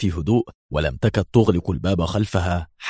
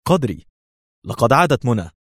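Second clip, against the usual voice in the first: raised-pitch call with good news; excited announcement; 4 semitones higher than usual. A narrator's reading. Agent telling his friend that Mona is back, excitedly. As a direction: announce it eagerly. Hossam bringing news of Mona's return.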